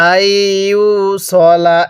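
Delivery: আইয়ুসলা slow, সলা short, never সালা; Quranic recitation. Shown as a demonstration. Teacher correcting a common mistake, slow recitation. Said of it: "এরকমভাবে পড়ব".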